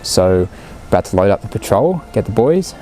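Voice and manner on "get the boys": ordinary talk, nothing marked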